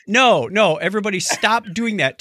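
ordinary delivery

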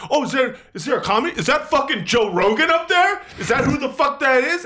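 high, loud dumb bro voice; mocking the couple. Couple mocking the comedian as Joe Rogan, high mock bro voice. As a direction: make them sound clueless and rude. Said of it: "they're like".

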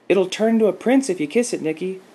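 put-on very high little-girl call; Lacey Peters calling out. A narrator's reading. Girl urges her friend to believe the fairy tale, calling out sincerely. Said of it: "Lacey Peters called out".

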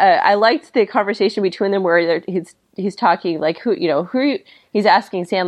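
ordinary talk, nothing marked

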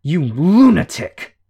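enraged, drawn-out growl; furious growl. A narrator's reading. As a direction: spit it out at the madman. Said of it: "Shadow growled the word".

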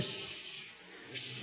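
sharp hush; hushing whisper. Acted. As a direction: shush the others urgently. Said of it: "somebody says".

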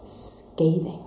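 whispered scathing. Real, not acted. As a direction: whisper the word "scathing" softly, breathy and hushed. Hushed word for the message.

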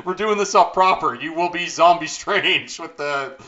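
loud, high mock director yell; Raimi shouting. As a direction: bark orders like a gung-ho director. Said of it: "and he's like".

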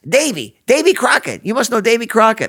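high, loud, grinning stranger's voice; eager stranger. Retold or imitated. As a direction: pester him like an over-friendly stranger at a gas station. Grinning stranger quizzes the narrator.

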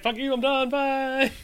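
high squeaky voice for a spoiled avocado. Pretend. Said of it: "they're just like".

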